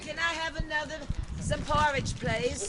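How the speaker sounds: begging orphan voice. Pretend. Begging for more porridge, Oliver.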